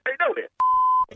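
high squeal. Real, not acted. Exclaiming at being set up.

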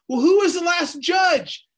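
high, loud rhetorical call; preacher calling out to the congregation; ringing question. Real, not acted. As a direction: throw the question out to the room. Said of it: "preacher calls out question".

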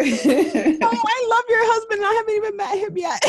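high, loud, emotional gushing; overflowing with delight, near tears; gushing excitement. Real, not acted. Host gushing over the guest's husband, high and loud.

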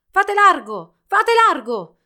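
high, very loud herald's shout; shrieked call. A narrator's reading. Herald clearing the road, shouting high. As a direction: clear the street with a big cry. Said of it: "strillò una voce lungo la strada".

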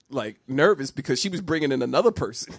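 usual speaking voice

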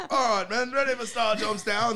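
loud, high military call; hammed-up parade-ground order; mock drill sergeant. Guest joining the army joke, mock drill sergeant. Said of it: "they'd be in the military".

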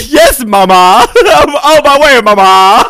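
high, loud send-up of the friend; mocking imitation. Friend teasing the storyteller's texts, mocking imitation. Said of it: "And I said, "Great, mama".

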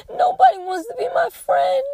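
high, loud tearful wail; imitating a sobbing child. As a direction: cry it out like a heartbroken little girl. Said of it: "And she cried her eyes out".

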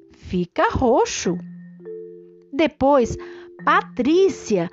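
plain reading voice

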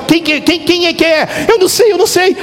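put-on high, eager child pointing at a photo; excited kid. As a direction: play the son, quick and high.

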